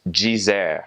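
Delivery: "dizer" opening on a "jee" sound; Brazilian j sound. Shown as a demonstration. Teacher showing the word for to say, Brazilian pronunciation. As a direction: say "dizer" slowly as jee-ZEHR. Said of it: "that will make a j sound".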